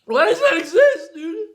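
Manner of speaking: laughing and crying at once; pained laugh. Real, not acted. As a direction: laugh through the pain. Laughing through the burn.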